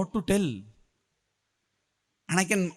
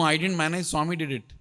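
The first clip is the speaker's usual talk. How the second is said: usual speaking voice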